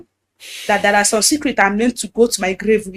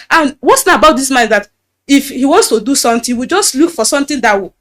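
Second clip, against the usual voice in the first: usual speaking voice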